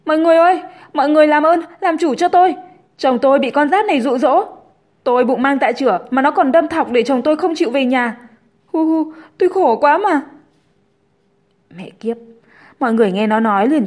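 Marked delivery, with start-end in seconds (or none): 0.0-10.2 high, loud acted wail to a crowd. A narrator's reading. Pregnant Linh begs bystanders for justice, hammed-up wailing. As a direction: play the wronged wife, crying out for help. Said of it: "liền khóc thét".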